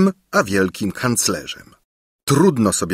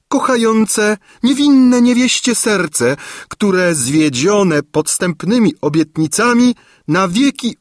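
much higher, louder indignant crone; screeching old lady; 12 semitones higher, 4 dB louder than usual. A narrator's reading. Gburia Furia demanding her rights from the court, high screech. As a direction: plead and accuse before the court.

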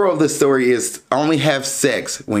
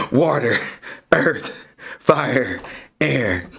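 dramatic narrator voice. Pretend. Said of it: "I'm, I'm playing".

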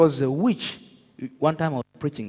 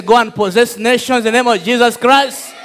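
louder and higher repeat, fully shouted; shouted charge; 10 semitones higher, 10 dB louder than usual. Shown as a demonstration. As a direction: raise it to a rallying shout. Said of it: "I say".

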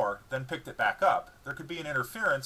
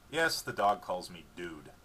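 ordinary delivery, nothing marked

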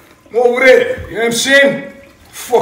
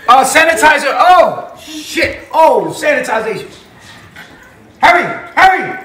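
usual speaking voice

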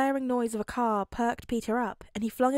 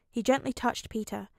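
ordinary delivery, nothing marked

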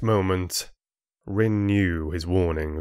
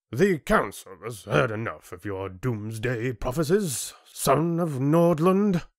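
dismissive chieftain; 5 semitones higher than usual. Acted. Chieftain ending Rin's audience, dismissive. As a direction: wave him away with grand finality.

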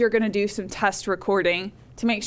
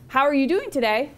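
"today" lifted in pitch like a real question; rising question; 3 semitones higher than usual. Shown as a demonstration. Teacher showing students a real question, rising intonation. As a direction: go up at the end on "today". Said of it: "You go up there".

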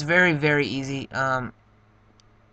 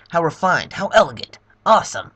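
louder, higher, delighted villain; gushing Bowser; 4 semitones higher, 8 dB louder than usual. Acted. Bowser admiring the silent princess, louder and delighted. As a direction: swoon over the princess. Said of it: "Let me get my Bowser voice ready".

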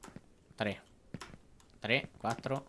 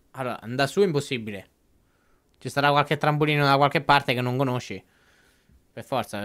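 usual speaking voice

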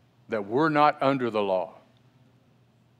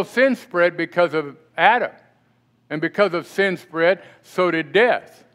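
forceful scripture reading; 3 semitones higher than usual. Acted. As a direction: proclaim the scripture with pastoral force. Reads Romans aloud.

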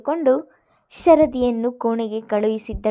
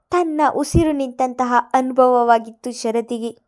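ordinary delivery

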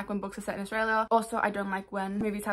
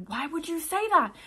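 higher, drawn-out incredulous protest; slow, wailed disbelief at the author; mock exasperation; 7 semitones higher, 3 dB louder than usual. Reader reacting to a cringy line in the book, exasperated.

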